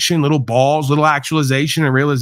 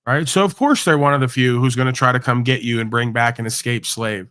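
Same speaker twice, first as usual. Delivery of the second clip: usual speaking voice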